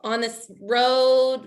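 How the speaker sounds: slow, drawn-out awkward report; hesitant retold call. Facilities caller reporting found pants, slow hesitant delivery. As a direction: stretch each phrase, pitched slightly up.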